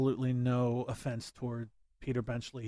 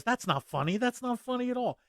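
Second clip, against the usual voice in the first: mock protest that rises as it repeats; imitated indignant chief; 9 semitones higher than usual. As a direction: pitch climbing high on the repeat. Voicing the chief's annoyed line.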